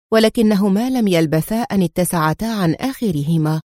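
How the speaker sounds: ordinary delivery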